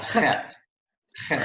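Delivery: chet starting with a throat-clearing scrape; throaty CH. Shown as a demonstration. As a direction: begin chet at the back of the throat. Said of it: "sound in the back of your throat".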